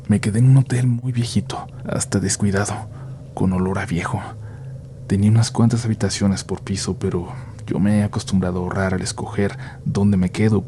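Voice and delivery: ordinary delivery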